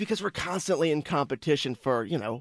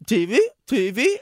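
put-on higher, eager little kid asking; toddler voice; 6 semitones higher, 4 dB louder than usual. Dad imitating his daughter asking the remote, childlike. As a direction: pipe it up like a toddler. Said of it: "she'd go".

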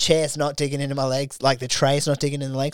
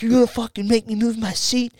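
higher-pitched, heated mock rant; imitating a furious passenger, voice pitched up; retold angry outburst; 8 semitones higher than usual. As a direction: act out the passenger flipping out at the flight attendant. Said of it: "flips out".

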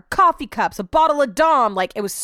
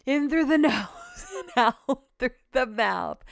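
half-joking meditation voice; calming breath guide; 2 semitones higher, slower than usual. Shown as a demonstration. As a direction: lead everyone through a slow breath, laughing a little. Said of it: "Let's all take a deep breath".

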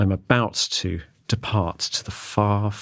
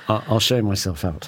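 ordinary talk

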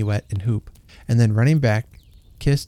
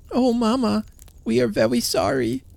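put-on sorry little boy; 13 semitones higher than usual. A narrator's reading. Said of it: "said the lad".

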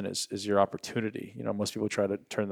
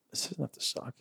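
soft whispered pep talk to himself; whispered self-talk; 5 semitones higher, 8 dB quieter than usual. Retold or imitated. Telling himself on the mountain, whispered. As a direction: whisper it as a realization.

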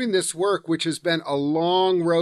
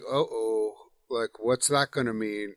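hushed, lowered mock worry; wary uh-oh; 7 semitones lower, 9 dB quieter than usual. Host voicing a wary reaction, hushed mock worry. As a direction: mutter it like a nervous aside.

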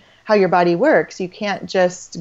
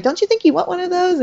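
much higher, teasing coax of a husband; husband's playful nudge; 11 semitones higher, faster than usual. Retold or imitated. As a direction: coax her like an eager husband, pitched way up. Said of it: "he'd be like".

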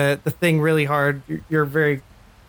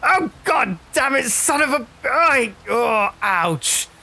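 much higher, yelping curses; pained cursing from the shirt; 10 semitones higher, slower than usual. Acted. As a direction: yell as if just hit hard. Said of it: "a voice inside of Miller's shirt go".

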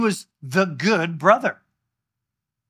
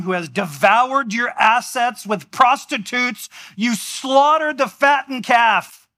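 rising higher and slower in outrage; mock-outraged older son; indignant older brother; 7 semitones higher, slower than usual. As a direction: let the resentment build. Resentful older brother complaint.

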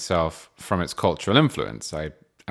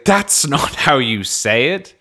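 higher, drawn-out mock protest; mocking kids; 6 semitones higher, 4 dB louder than usual. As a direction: slowly imitate children mocking their dad's speech. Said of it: "they mock me".

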